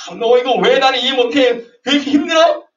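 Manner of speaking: high, loud bullying demand; browbeating. Acted. Someone judging another, high loud browbeating. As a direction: browbeat the other person. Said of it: "이렇게 막 윽박지르지".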